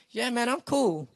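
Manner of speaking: holding back tears. Retold or imitated. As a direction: act cool while choking back tears, mock. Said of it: "trying to pretend you haven't been".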